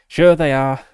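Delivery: loud, dismissive cop; sarcastic policeman. Acted. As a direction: scoff at the prisoner loudly.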